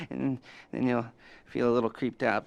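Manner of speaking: ordinary talk, nothing marked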